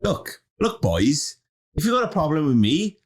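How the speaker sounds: put-on light Welsh accent; a touch of Welsh accent. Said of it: "I'd do it in a Welsh accent".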